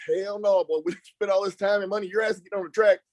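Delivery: mock-exasperated dad; dad's refusal. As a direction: imitate an indignant father putting his foot down. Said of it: "My dad goes".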